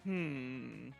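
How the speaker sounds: usual speaking voice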